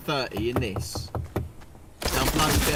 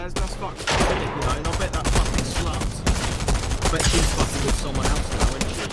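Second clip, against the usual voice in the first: bitter and angry; 2 semitones higher, 5 dB louder than usual. Real, not acted. Mate ranting to friends about his dead friend's ex, angry and louder. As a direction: spit it out with contempt.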